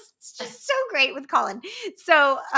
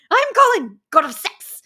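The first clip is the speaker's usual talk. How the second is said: high, loud boast as a film character; cocky Colin impression; 7 semitones higher, 8 dB louder than usual. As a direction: proclaim it like a self-styled heartthrob. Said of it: "And then he says".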